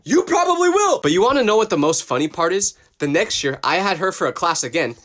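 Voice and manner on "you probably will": high, put-on deadpan voice; dry mock reply. Students answering the teacher's joke, high mock sass. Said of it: "we were like".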